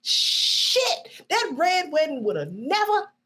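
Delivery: high-pitched, drawn-out outrage; worked-up high wail of protest; exasperated rant. Real, not acted. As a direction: stretch the key words in exasperation. Exasperated rant at Robb Stark.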